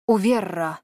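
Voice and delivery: a spell word in a mildly hoarse voice; hoarse mutter. A narrator's reading. Woman casts a spell at her companion's belt, hoarse mutter. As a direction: utter it like an incantation, a touch hoarse. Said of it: "хрипло пробормотала она".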